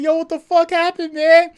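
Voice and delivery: high, loud panicked yell; mock Pranksy losing it, high and loud; freaked-out yell. Streamer imitating Pranksy's high, loud freak-out. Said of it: "he was like".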